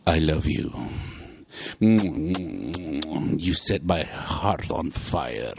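low, slow French lover's croon; Pepe Le Pew impression. Husband imitating the cartoon skunk wooing the cat, slow low croon. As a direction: woo her like a lovesick French skunk. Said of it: "And he was like".

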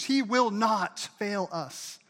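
very slow, every word spaced; stress on NOT. Shown as a demonstration. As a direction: lay out "He will not fail us" slowly. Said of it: "Say "not".

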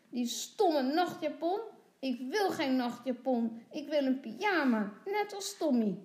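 put-on tearful little girl. A narrator's reading. Lotje protests about her nightgown, tearfully. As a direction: whine as a child starting to cry. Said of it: "Lotje begint te huilen".

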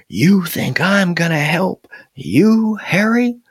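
high, scornful mama raccoon retort; indignant challenge. A narrator's reading. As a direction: challenge accusingly, drawing out the words.

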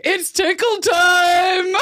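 high, loud, drawn-out playful shout; gleeful attack cry. Retold or imitated. Siblings announcing a tickle attack on mom, gleeful shout. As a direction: stretch it into a mischievous battle cry. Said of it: "And we're like".

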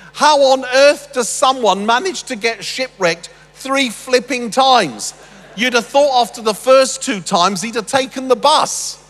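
incredulous joke. Real, not acted. Preacher joking to congregation about Paul's shipwrecks, loud mock disbelief. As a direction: throw your hands up at the absurdity.

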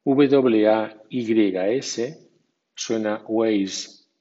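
ordinary delivery

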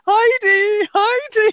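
put-on high, teasing child voice; sing-song child taunt. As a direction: chant the name like a playground taunt.

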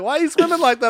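high, loud, mock-puzzled question; lightly voiced kids laughing at him. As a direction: squeak it out like a confused kid. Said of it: "They're like".

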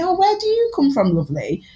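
high-pitched friendly old lady, lightly imitated; little old Irish lady. Old Irish lady asking a shop worker where she's from, high-pitched imitation. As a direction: lilt it up high, then drop on the last word. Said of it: "this little old Irish lady".

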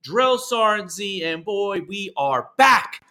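big, slow show-host welcome; announcer voice. Acted. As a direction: hype up the show open like a radio announcer. Host opens show announcer-style.

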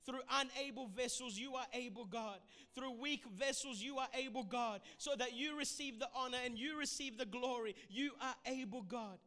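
fervent rising intercession; rally the room in prayer; rally speech. Acted.

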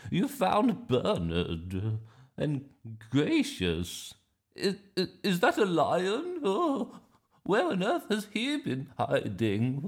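high, loud, astonished grandma voice; flustered grandma. Acted. Grandma spotting the bear and lion, loudly astonished. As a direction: stammer and exclaim as an amazed granny, ending in a chuckle. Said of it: "she said".

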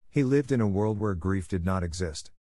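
usual speaking voice

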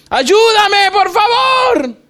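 high, drawn-out cry for help; pleading shout. Acted. Hanging man begs God to save him, shouting. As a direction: yell a plea up the cliff.

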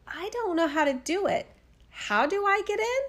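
put-on high-pitched voice of a helpless little girl; puzzled girl. A narrator's reading. Gretel fooling the witch at the oven, puzzled high voice.

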